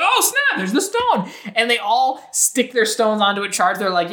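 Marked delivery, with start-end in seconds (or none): 0.0-1.3 high, mock-excited yelp. The werewolves spot the stone, mock excited. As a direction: play it up like kids finding treasure.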